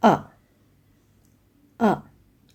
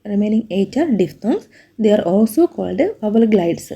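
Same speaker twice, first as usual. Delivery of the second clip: slow reading aloud; much slower than usual. Shown as a demonstration. Teacher dictating a definition, very slow. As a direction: read the definition out very slowly.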